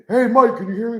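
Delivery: loud and high mic check; shouted mic test; yelling into mic. Shown as a demonstration. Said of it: "yell, like".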